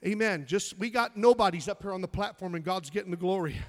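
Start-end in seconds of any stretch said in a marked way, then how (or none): none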